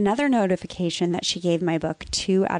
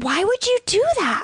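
high, loud, hurt demand; indignant outburst; 4 dB louder than usual. Retold or imitated. Niece retelling how she confronted her aunt, outraged. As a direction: throw the question at her in outrage. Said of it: "I said".

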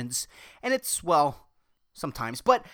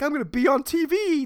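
dumb mentality; 8 semitones higher than usual. Retold or imitated. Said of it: "That fucking dumb mentality of".

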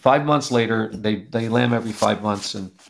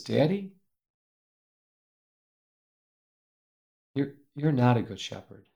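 soft, choked tearful words; tearful child; 7 dB quieter than usual. Retold or imitated. Heartbroken daughter telling her dad he failed, soft and tearful. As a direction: hold back tears as you say it. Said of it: "with tears streaming down her face".

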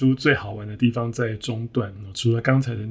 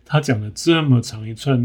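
ordinary delivery

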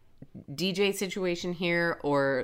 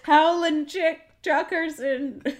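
mock announcer; 11 semitones higher, 7 dB louder, slower than usual. Mock dramatic name call.

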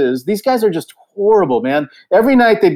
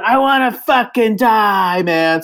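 mock wail; 5 semitones higher, slower than usual. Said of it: "just going".

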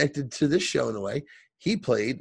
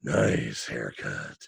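much lower, very slow muttered line; gruff muttered aside; 13 semitones lower, 4 dB quieter, much slower than usual. Retold or imitated. Mick muttering to himself about the haircut, low and slow. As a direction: drop way down and drag it out to yourself. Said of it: "Mick says to himself".

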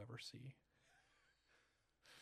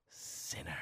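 low, drawn-out hissed whisper; whispered accusation; 8 semitones lower than usual. Acted. Mother Byrne pointing her stave at you, whispered condemnation. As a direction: whisper it slow and menacing. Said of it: "and she s-, whispers".

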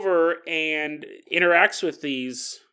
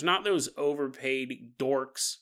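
normal talking voice

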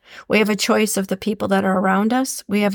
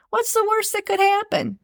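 upbeat; 12 semitones higher than usual. Shown as a demonstration. Upbeat repeated motto.

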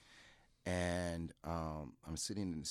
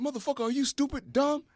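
high, louder mocking insult; a much higher and louder taunting shout; retold taunt; 9 dB louder than usual. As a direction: jeer at the rookie. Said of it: "He said".

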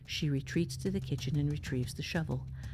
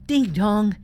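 sudden booming ding dong, pitched higher; loud doorbell imitation; 5 semitones higher, 14 dB louder than usual. A narrator's reading. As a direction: blast it out like a startling doorbell. Doorbell rings out suddenly in story.

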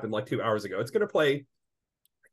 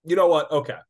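louder, exasperated snap back; losing patience with the clerk; fed-up retort; 3 semitones higher, 6 dB louder than usual. Retold or imitated. Retelling his clash at the BMV, a louder exasperated retort. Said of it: "I'm like".

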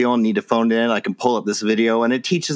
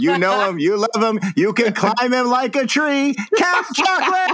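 climbing from usual pitch to a high peak; hype announcer; 11 semitones higher than usual. Real, not acted. Host introduces the co-host like a star, rising fanfare. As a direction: build the introduction into a big high name reveal. Host builds up next co-host's name.

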